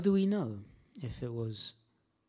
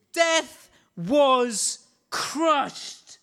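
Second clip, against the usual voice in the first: drawn out; 3 semitones higher, much slower than usual. Real, not acted. Repeated exclamation, slowed.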